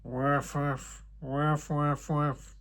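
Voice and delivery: head elf grudgingly barking; grumbling woofs. A narrator's reading. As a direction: bark under protest. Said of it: "Chef lutin ronchonne".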